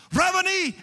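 high-pitched exclamation of recognition; joyful shout. Acted. Mary recognizes the risen Jesus and shouts to him, shouting. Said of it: "because she shouts it".

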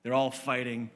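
usual speaking voice

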